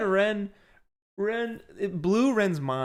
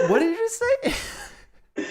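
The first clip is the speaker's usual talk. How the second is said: laughing disbelief; much slower than usual. Retold or imitated. Friends laughing at Ren.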